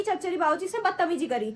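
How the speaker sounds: ordinary talk, nothing marked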